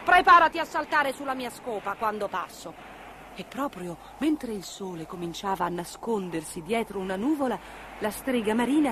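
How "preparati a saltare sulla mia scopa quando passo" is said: high, loud witch voice; impatient witchy instruction; commanding sea witch. Acted. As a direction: bark the order to the cat. Witch orders the kitten.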